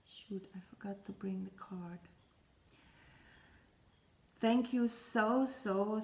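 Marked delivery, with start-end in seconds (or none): 0.1-2.0 much softer, half-whispered aside to self; hushed aside. Real, not acted. Vlogger realising she forgot the card, hushed aside. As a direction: drop the volume, nearly whispering.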